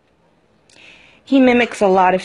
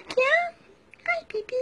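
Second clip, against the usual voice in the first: very high and much softer cooing; 9 dB quieter than usual. Real, not acted. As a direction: coo tenderly up high, almost under breath. Cooing to bird.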